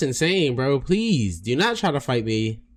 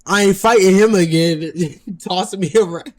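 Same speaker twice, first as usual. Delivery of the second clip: loud mock of the worn-out big guy; tired mock voice; 9 semitones higher, 12 dB louder than usual. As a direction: ham up a worn-out loser quitting.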